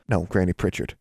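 usual speaking voice

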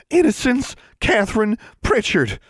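put-on very high, scandalized girl voice; appalled older sister. A narrator's reading. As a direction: repeat the full name in outrage. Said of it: "Constance repeated".

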